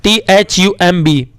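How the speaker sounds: टी एच यू एम बी spelled slowly, falling on एच; name each letter slowly; spelling slowly. Shown as a demonstration. Teacher spelling thumb slowly for children. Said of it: "चौथा भी".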